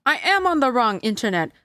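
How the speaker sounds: loud dismayed realization; exasperated realization. Real, not acted. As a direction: blurt it out in frustration. Realizing the stream problem.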